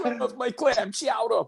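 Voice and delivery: high, slow mock Boston whine; mock Boston accent. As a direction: ham up a Boston fan wanting his chowder.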